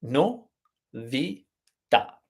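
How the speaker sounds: "no, vi, ta", each syllable set apart by a pause. Shown as a demonstration. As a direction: count out no, vi, ta one at a time. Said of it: "we have three syllables".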